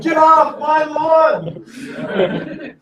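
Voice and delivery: put-on slow, high, laughing cranky old man yell; grumpy old man. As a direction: shake your fist at the kids, laughing. I think you're just old-fashioned.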